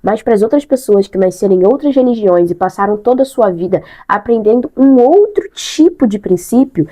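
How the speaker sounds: ordinary talk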